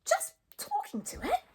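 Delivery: very high, disbelieving echo; squeaky astonishment, rising at the end; astonished Fishlegs. A narrator's reading. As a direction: repeat it as an incredulous question. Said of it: "gasped Fishlegs in astonishment".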